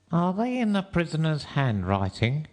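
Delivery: high-pitched juror's question; another juryman. A narrator's reading. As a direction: ask as a high-voiced juror. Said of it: "asked another of the jurymen".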